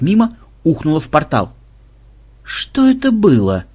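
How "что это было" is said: usual speaking voice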